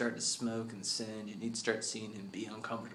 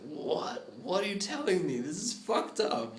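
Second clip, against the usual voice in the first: higher and louder, stunned; shocked disbelief; 8 semitones higher, 4 dB louder than usual. Acted. Kid hearing Crime Face is his father, shocked disbelief. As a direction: react like the floor dropped out. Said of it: "And Dish Pit's like".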